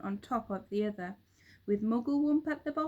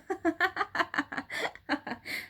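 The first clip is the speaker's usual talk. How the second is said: high, far-off jeering bird laughter; high cackling laughter; 9 semitones higher than usual. A narrator's reading. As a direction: cackle like a flock mocking him. Birds laughing at Mister Twit.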